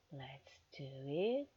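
slow unvoiced whisper; slow hushed coax. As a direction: breathe it slowly, coaxing. Coaxing partner in roleplay.

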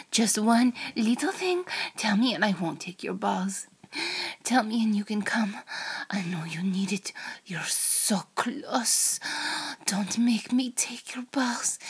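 put-on half-whispered threat in a Russian accent. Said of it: "in a Russian accent".

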